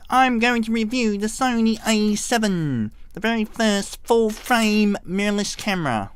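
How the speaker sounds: mock YouTuber presenting a camera; obnoxious reviewer. Reviewing the Sony A seven, obnoxious mock presenter voice. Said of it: "just do something really obnoxious".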